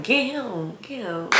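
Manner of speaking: soft, teasing little call; playful taunt. Retold or imitated. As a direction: tease them softly, letting it fall away. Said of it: "I'm literally talking just like this".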